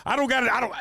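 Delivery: high, loud, fast venting; fed-up outburst. Retold or imitated. About his father's opinion, a high, loud fed-up rant. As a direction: let it all pour out at once.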